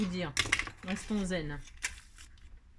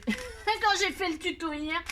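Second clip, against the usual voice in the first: laughing; 8 semitones higher, slower than usual. Real, not acted. Laughing at her failed attempt.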